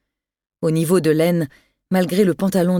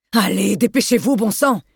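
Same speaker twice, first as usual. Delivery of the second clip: urgent yell; 3 semitones higher than usual. Acted. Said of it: "hurla-t-il afin de sortir Delorme de sa torpeur".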